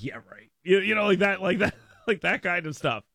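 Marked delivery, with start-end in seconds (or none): 0.0-0.5 low, soft throwaway scoff; dismissive. Retold or imitated. Coworkers brushing off a suspicious email, dismissive. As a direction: toss it off quietly in disbelief. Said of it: "And they're like".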